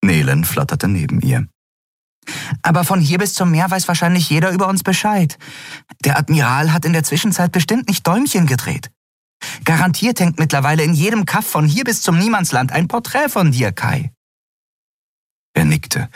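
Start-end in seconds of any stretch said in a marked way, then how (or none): 2.6-14.1 high, quick, chattering little voice; small winged creature. A narrator's reading. Small flying companion warns Kai, high and quick. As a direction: chirp it out fast and worried.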